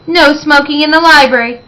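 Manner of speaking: a dry, pointed retort. A narrator's reading. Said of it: "said dryly".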